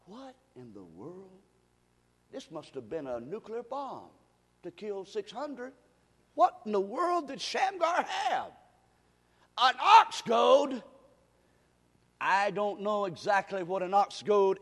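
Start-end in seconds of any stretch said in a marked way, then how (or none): 0.0-5.7 barely audible pulpit aside; hushed amazement. Acted. Preacher drops to hushed amazement. 6.3-10.8 higher-pitched pulpit exclamation; loud preacher's disbelief; incredulous preaching. Real, not acted. Preacher bursts out in amazement.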